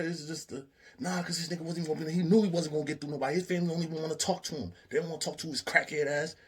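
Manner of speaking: high, fast, heated complaint; heated rant. Retold or imitated. Guy complaining to Meech about Chip, fast and heated. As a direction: rattle it off, fed up.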